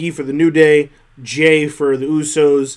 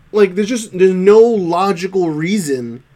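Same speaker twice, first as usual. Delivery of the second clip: ordinary talk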